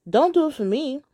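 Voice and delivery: high-pitched, insistent objection; protesting. Acted. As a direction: push back on the idea. Girlfriend protests in acted phone talk.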